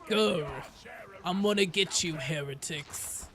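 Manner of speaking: growling dog voice. Shown as a demonstration. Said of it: "bring me on for a dog voice".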